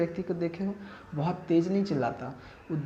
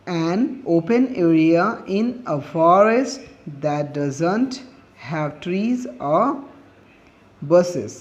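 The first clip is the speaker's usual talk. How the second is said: slow, word by word, for students to copy down; slow dictation; 5 dB louder, much slower than usual. Acted. Teacher dictating a definition to students, slow and deliberate. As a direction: dictate it so every word can be written.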